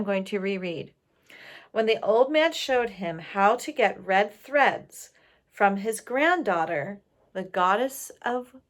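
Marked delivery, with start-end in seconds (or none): none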